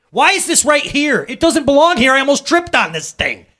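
high, loud acted scolding; parent's temper. Stay-home dad rails at kids' clutter, acted temper. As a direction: play an exasperated parent yelling about clutter. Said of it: "one that always gets me".

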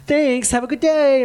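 high, slow, sugary customer politeness; polite to cashier. Retold or imitated. Speaker mocks his politeness to the Walmart cashier, sugary high. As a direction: lay on the cashier niceness. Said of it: "I'm politer to the cashier".

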